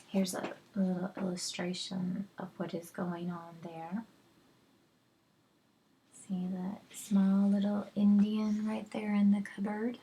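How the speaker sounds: quiet whispered aside to the audience; hushed teacher's aside; soft aside. Real, not acted. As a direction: lean in and point it out softly. Reader aside to listeners.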